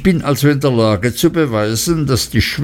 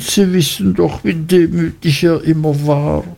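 high, pleading mother's voice; 7 semitones higher than usual. A narrator's reading. Mother begging the gentlemen to spare her son, high pleading. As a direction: beg the gentlemen on your son's behalf. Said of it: "rief die Mutter".

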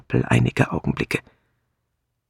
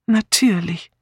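higher-pitched, faraway answer; absent-minded; 7 semitones higher than usual. A narrator's reading. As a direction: answer as if lost in thought. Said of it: "antwortete Miss Marple wie geistesabwesend". (wie geistesabwesend geantwortet)

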